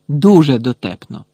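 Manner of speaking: loud biting sarcasm; sarcastic. A narrator's reading. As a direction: throw it back sarcastically. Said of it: "саркастично звернулася Герміона".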